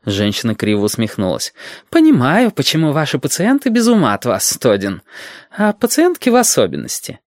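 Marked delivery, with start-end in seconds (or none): 1.9-7.2 very high, teasing woman's voice; needling woman. A narrator's reading. Woman taunts Todin about his patients, high and mocking. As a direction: say it high, with a crooked smirk.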